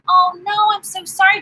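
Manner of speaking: shocked sympathy that lifts at the end; dismayed sympathy. Real, not acted. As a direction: gasp out the sorry in dismay. Hearing a viewer was hospitalised.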